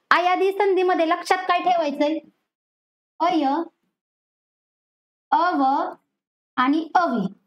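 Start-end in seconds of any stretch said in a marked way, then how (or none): 3.2-7.3 अय, अव, अवी each said separately as "ay, av, avi"; teacher modelling अय, अव, अवी as isolated sounds; demonstrated sounds. Shown as a demonstration. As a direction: sound out अय, अव, अवी one by one, with long gaps. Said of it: "असा उच्चार ज्या ज्या ठिकाणी".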